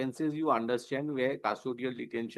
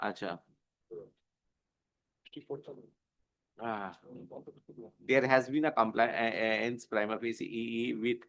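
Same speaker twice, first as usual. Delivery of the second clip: measured, formal dictation of an order, slightly lower; dictating a court order; 4 semitones lower than usual. Acted.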